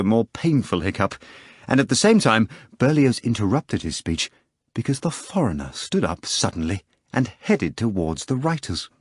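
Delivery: plain reading voice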